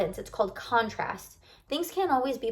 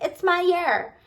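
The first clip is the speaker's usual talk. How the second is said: upbeat boast; 7 semitones higher, 5 dB louder, slower than usual. Retold or imitated. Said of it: "everyone last year was like".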